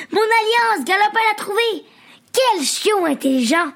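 high, joyful cry of relief; mother overjoyed at finding her lost ring, exclaiming high; delighted exclamation. A narrator's reading. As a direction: mum thrilled to find her ring. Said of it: "s'exclama-t-elle".

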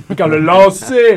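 loud, drawn-out mock commentary; mock announcer suddenly belting it; baseball announcer. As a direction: stretch the call out with sudden volume. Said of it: "Là, ils disent rien".

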